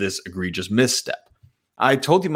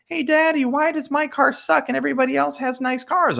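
put-on much higher little-girl voice; whiny daughter. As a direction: play the pouting teenage daughter, pitched way up. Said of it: "she's gonna be like".

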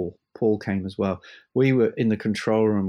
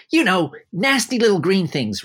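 high and loud, theatrical frog disgust; camp theatrical conductor; 8 semitones higher, 4 dB louder than usual. Retold or imitated. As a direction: camp maestro explaining with a croak. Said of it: "a very theatrical voice, let's say, kind of camp".

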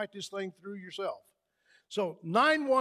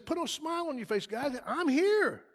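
higher, reassuring call to the disciples; voicing Jesus; 7 semitones higher than usual. Preacher voicing Jesus reassuring disciples in the boat, higher. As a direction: lift the voice and comfort them. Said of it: "So he says".

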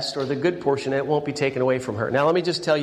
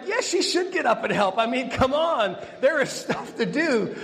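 mock exasperation; 7 semitones higher than usual. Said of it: "but I'm like".

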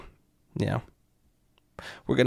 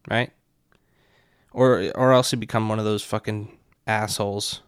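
ordinary talk, nothing marked

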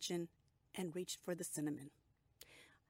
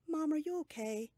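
high, louder worried daughter asking; daughter's worried voice; 9 semitones higher, 6 dB louder than usual. A narrator's reading. Liz checks on her mother, higher and worried.

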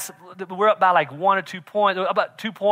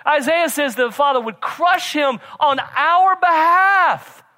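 loud, high, driving preacher's cry; full-force preaching; 9 semitones higher, 6 dB louder than usual. Acted. Pastor shouting the gospel to the congregation, full force. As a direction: preach it out at the top of the voice.

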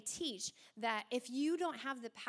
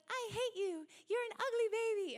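put-on sweet baby talk; 9 semitones higher than usual. Preacher mimics baby video talk in a cooing sweet tone. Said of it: "'Cause the tone is all happy".

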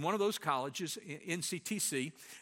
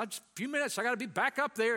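hurried reply; 6 semitones higher, 4 dB louder, faster than usual. Retold or imitated. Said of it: "I said".

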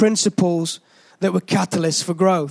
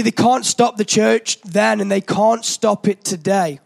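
ordinary talk

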